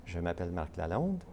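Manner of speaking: ordinary talk, nothing marked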